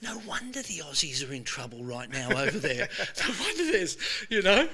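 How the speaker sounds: retold inner thought, laughing through it; laughing disbelief. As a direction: chuckle at how absurd the advice sounds. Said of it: "but I was like".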